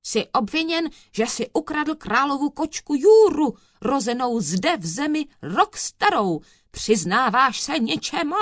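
high-pitched, forceful accusation; stern judge. A narrator's reading. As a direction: lay the charge on the accused. Judge thunders the charge at accused.

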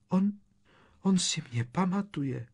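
high, weak, frightened voice; faint with shock. A narrator's reading. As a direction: say it as if about to faint. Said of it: "zatvářila se, jako by na ní šly mdloby".

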